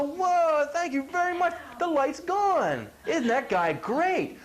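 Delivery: high, slow, gushing thanks; mock gushing thanks. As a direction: play the fooled customer amazed and grateful. Mock grateful customer.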